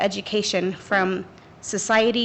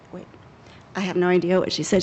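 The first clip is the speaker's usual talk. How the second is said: ordinary talk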